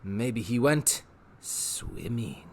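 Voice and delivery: slow, sly, teasing menace; lazy sly Rictus. Acted. Rictus hinting Wendell's fate, slow sly tease. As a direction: drop it lightly, savouring it.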